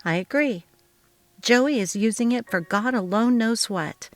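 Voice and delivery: ordinary delivery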